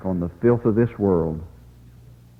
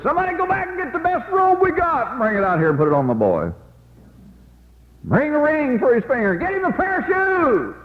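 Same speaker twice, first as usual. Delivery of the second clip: very high-pitched hollering to servants down the road; overjoyed father's high, sustained yell; joyful calling out. Acted. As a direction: holler orders, voice shooting way up. Overjoyed father calls to his servants.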